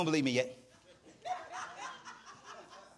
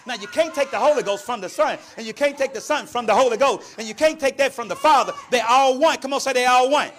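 louder, higher pulpit voice; building preaching; 6 semitones higher, 10 dB louder than usual. Real, not acted. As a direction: build it up and get the congregation saying it. Preaching builds to congregational call.

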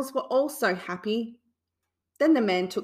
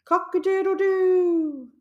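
slow, high, louder crow; rooster crowing; 10 semitones higher, 5 dB louder, slower than usual. A narrator's reading. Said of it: "the rooster crowed".

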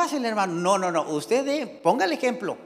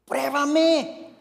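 preacher voicing the Lord's invitation, raised and commanding; the Lord's challenge; 6 semitones higher than usual. Shown as a demonstration. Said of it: "El Señor no dice así".

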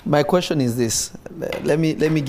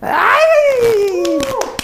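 laughing shout; 6 dB louder than usual. Real, not acted. Preacher bursts out laughing.

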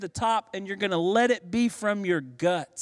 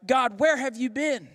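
higher-pitched plea to an absent God; imploring, pitched-up lament; acted lament; 7 semitones higher than usual. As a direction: cry out a complaint to God. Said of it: "prayers of lament, they often reflect".

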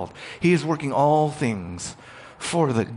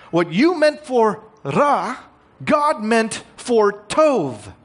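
high, loud and slow, every word weighted; preaching climax; 10 semitones higher, 5 dB louder, slower than usual. Acted. Pastor drives home the theme to the church, slow and loud. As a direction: proclaim it like the heart of the sermon. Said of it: "climactic statement of theme".

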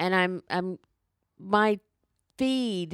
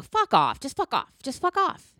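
each "fuck off" pitched higher, building to a cry; rising rant; 6 semitones higher, 4 dB louder than usual. Real, not acted. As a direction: escalate the "fuck off"s in exasperation. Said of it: "I'll stop screaming now".

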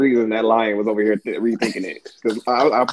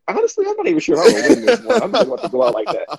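higher mock lion line through laughter; lion, laughing; 12 semitones higher than usual. Lion doesn't want this fight, laughing mock voice. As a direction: giggle it out as a reluctant lion. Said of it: "The lion was like".

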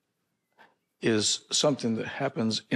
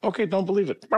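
breezy dismissal; 9 semitones higher, 3 dB louder, much faster than usual. Retold or imitated. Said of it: "I said".

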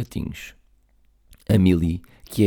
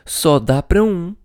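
hurried; 6 semitones higher, 5 dB louder than usual. Acted. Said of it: "apressou-se a dizer".

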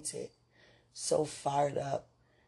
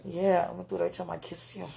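ordinary talk, nothing marked

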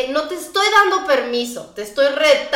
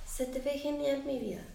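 softly, gently; 13 dB quieter than usual. Shown as a demonstration. A husband softly reassuring his wife about her looks, tender. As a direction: murmur it tenderly, like soothing her.

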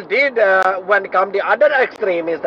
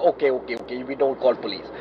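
mock-meek, much softer and lower okays; retreating quietly as if caught out; hushed backing down; 9 semitones lower, 8 dB quieter, faster than usual. Retelling the neighbours giving in, hushed and meek.

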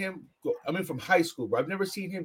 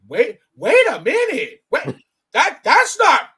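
stunned disbelief; 12 semitones higher, 10 dB louder, slower than usual. Retold or imitated. Retold shock at the blowup.

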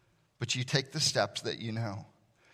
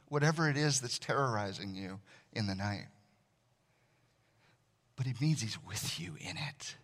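ordinary delivery

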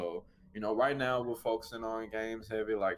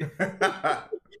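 laughing; 9 semitones higher, 6 dB louder than usual. Real, not acted. Laughing at old boot times.

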